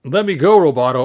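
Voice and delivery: loud, high, frantic cry to be let go; loud yell. Acted. Orko yells at Roboto to let go. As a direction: shout it as a trapped little wizard. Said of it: "Orko yelled".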